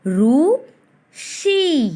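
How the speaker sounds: रु then शी with the ई held long; drawn-out ई in शी; long ee sound. Shown as a demonstration. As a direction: stretch the ई in शी. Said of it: "बड़े वाली मात्रा को इस तरह से खींचना पड़ता है".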